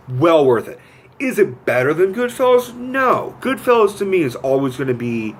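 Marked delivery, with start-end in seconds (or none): none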